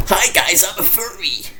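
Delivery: goofy high-pitched furry voice; squeaky silly voice. Acted. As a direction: jump way up into a tiny squeak. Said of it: "that sounded weird".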